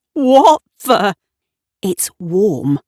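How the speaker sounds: sharp alarmed gasp of words; startled. A narrator's reading. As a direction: caught off guard. Gavin feels the warm bottle.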